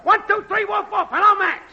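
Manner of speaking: high-pitched mic-check holler; woofing and yelling. Acted. Technician testing the network for Max, woofing and yelling. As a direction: yell out a test call to Max. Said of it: "woofing and yelling, "Hello, Max".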